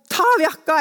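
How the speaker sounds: sharp, loud order from a short-tempered teacher; irritated teacher. Retold or imitated. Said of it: "læreren er litt sånn småhissig".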